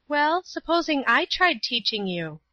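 put-on high girl's voice, a touch of timidity. A narrator's reading. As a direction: offer it tentatively in a high voice. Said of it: "said Paula timidly".

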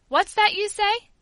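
high, loud, drawn-out demand; stern interruption. A narrator's reading. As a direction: cut in as a displeased father. Stern father interrupts the prayer.

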